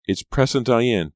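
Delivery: high, loud, urgent cry; frightened girl crying out; cried out. A narrator's reading. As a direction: call out the warning in alarm. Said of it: "Pam cried".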